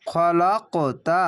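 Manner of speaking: "খলাকতা" chanted slowly, the k bounced upward; qalqalah bounce. Shown as a demonstration. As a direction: push the k in "খলাকতা" with a jolt, sound going up. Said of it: "কলকলাটি ধাক্কা মেরে ওপরে sound যাবে".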